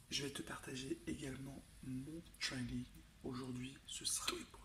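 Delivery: quiet whispered aside; library whisper. Real, not acted. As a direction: hush it, still early. Still whispering, early morning.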